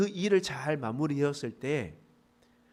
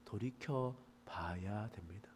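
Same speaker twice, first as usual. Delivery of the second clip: whisper; 5 semitones lower, 11 dB quieter, slower than usual. Real, not acted. Sermon climax drops to whisper.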